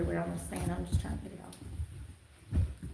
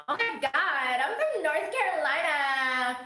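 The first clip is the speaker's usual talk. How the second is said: high, slow, hammed-up North Carolina twang; exaggerated Southern accent; 7 semitones higher, 3 dB louder, slower than usual. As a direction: ham up the North Carolina drawl. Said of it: "you're like".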